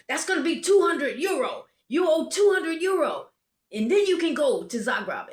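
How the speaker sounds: high, loud imitated official ultimatum; loud demand. Border guard demands money before traveler can go, loud demand. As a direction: lay down the fine forcefully.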